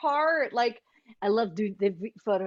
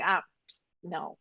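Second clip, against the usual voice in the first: soft, underplayed dying line; half-hearted game death; 5 semitones lower, 5 dB quieter than usual. Tired actor giving a mediocre game death, half-hearted. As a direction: die without bothering, voice dropping away. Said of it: "tired of mediocre game deaths".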